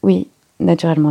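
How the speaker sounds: plain reading voice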